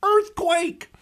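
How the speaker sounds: high, loud yell; yells earthquake. Retold or imitated. Second prisoner yelling at the firing squad, high loud yell. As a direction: shout it to fake a disaster. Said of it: "and he yells".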